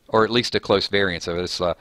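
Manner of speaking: usual speaking voice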